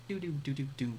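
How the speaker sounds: tonal falling doos like drum toms; tom fill. Shown as a demonstration. Teacher imitates a tom fill for the class, falling tonal doos. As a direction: sing the toms downward. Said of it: "Toms, which are a little more tonal".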